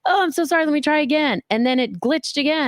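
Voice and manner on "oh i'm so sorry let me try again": flustered apology. Retold or imitated. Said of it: "And I'm like".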